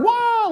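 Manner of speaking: high mock yelp of a flopping kid; flailing child yelp. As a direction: squeal like a child thrown in the water. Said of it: "like that".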